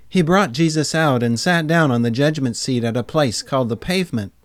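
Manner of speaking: usual speaking voice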